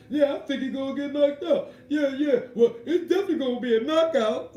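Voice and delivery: high, loud put-on commentator voice mocking the hype. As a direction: ham up a corny ringside commentator. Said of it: "just talk about them".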